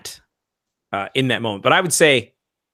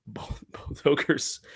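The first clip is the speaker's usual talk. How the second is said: softer, whispered through a laugh; half-whispered, holding back laughter; hushed; 2 semitones higher, 7 dB quieter, much slower than usual. Real, not acted.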